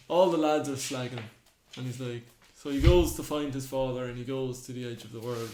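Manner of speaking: ordinary talk, nothing marked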